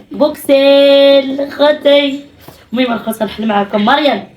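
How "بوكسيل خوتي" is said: slow, loud, drawn-out build-up; teasing slow announcement. Real, not acted. Mother to viewers, dragging out the unboxing announcement, slow and loud. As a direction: stretch it out to tease the unboxing.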